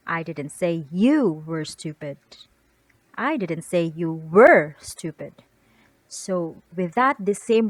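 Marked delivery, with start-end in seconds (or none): 0.1-2.2 "you" lifted and leaned on; stress on YOU. Shown as a demonstration. Teacher shifts the emphasis to you for her students. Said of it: "The word emphasis is different". 3.1-5.4 slower, louder, "were" hit hard; stress on WERE. Shown as a demonstration. Teacher demonstrates stress on were for the class. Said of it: "The word emphasis is different".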